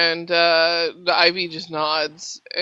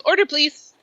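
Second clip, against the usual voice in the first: waitress asking for the order; 8 semitones higher than usual. Acted. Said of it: "she looks at you intently and goes".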